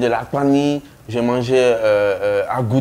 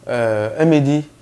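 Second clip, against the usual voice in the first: ordinary delivery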